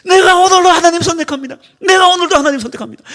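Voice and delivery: loud declaration like a chant; shouted slogan. Pretend. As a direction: shout it like a morning cadence. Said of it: "구호하고 목소리 지르듯이".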